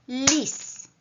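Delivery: "Lis" as one single high beat; one-syllable beat. Shown as a demonstration. Teacher clapping out syllables with pupils, "Lis" as one beat. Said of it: "Podzielmy teraz każdy z tych wyrazów na sylaby".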